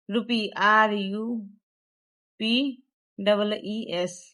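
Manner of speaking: slow letter by letter, आर U P double E S; spelled out. Shown as a demonstration. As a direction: dictate each letter slowly to the class. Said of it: "spelling the word for pupils".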